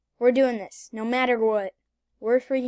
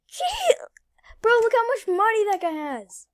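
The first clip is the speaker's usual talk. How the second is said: amazed; 9 semitones higher, 4 dB louder than usual. Real, not acted. Amazed at someone's money.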